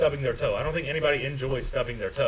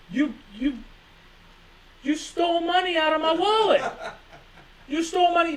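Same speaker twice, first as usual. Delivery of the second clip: mock accusation; 12 semitones higher than usual. Acted accusation, high.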